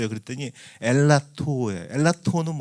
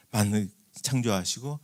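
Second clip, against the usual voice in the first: ordinary talk, nothing marked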